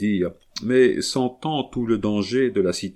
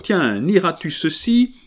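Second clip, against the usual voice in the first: angry challenge; 8 semitones higher, 4 dB louder than usual. A narrator's reading. Wife brandishes the letter.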